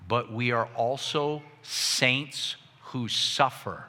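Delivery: slow and grave, with spaces between phrases; slow, deliberate emphasis. Real, not acted. Preacher completes the key point for the congregation, slow and deliberate. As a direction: let each phrase land slowly. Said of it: "hear this clearly".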